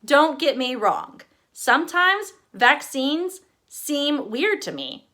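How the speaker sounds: ordinary delivery, nothing marked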